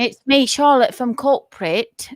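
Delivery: hamming up the corporate caller; mock English caller. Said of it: "then you're gonna go".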